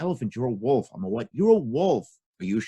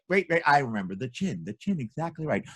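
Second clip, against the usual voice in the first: ordinary delivery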